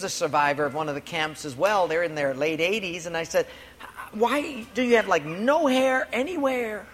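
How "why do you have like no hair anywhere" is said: tentative baffled question. Retold or imitated. Retelling his awkward question to survivor.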